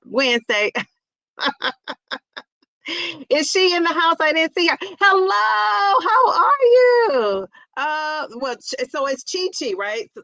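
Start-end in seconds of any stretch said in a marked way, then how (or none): 4.8-7.5 high, drawn-out sing-song hello; excited high greeting. Real, not acted. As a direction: call out to her with delight. Greeting a viewer who joined.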